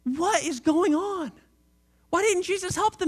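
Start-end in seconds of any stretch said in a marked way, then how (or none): none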